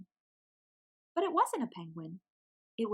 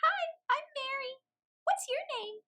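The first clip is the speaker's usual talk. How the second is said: much higher, squeaky chick voice; squeaky little penguin. A narrator's reading. Mary's held voice.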